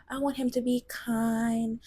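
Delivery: lightly mocking imitation of women contestants; mimicked dating-show women. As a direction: parrot a dating-show wish list, mockingly. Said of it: "That's what y'all be saying".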